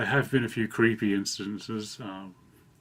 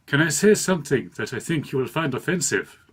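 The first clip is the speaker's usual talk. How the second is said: raised, louder imitated Indian accent; mock Indian accent, pitched up; 5 semitones higher, 5 dB louder than usual. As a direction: put on an amateur Indian accent. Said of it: "I'm gonna do an accent".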